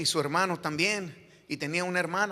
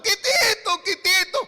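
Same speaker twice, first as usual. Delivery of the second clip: loud, very high mock weeping cry; imitated tearful wailing; 10 dB louder, slower than usual. Mother running up to the preacher in tears, imitated wailing. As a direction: wail the nickname like a distraught woman. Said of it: "una señora llorando, llorando".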